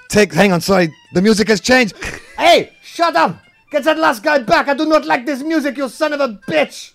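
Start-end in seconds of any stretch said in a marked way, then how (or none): 2.4-6.9 high angry French yell. Acted. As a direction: shout at the street musician. Yelling at the musician.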